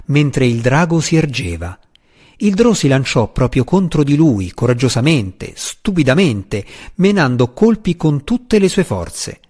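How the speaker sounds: plain reading voice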